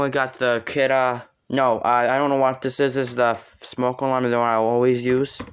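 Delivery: normal talking voice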